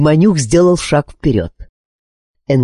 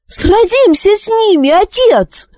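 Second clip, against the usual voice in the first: put-on very high, excited boy's cry; high-pitched battle call; boyish shout; 3 dB louder than usual. A narrator's reading. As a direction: cry out like an eager young fighter. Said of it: "закричал Зар".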